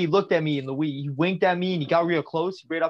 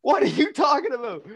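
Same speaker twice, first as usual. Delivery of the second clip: rising, higher incredulous outburst; mock baffled cry, climbing higher; retold disbelief; 7 semitones higher than usual. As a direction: throw it back at him in shocked disbelief. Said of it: "I'm like".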